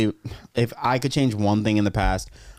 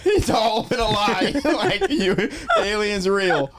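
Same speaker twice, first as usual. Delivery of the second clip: laughing; 9 semitones higher, slower than usual. Real, not acted. Crying with laughter.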